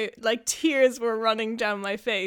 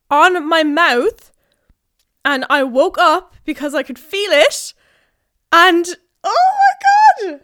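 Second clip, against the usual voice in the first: loud disgust; 6 semitones higher, 11 dB louder than usual. Real, not acted. Moth on mouth.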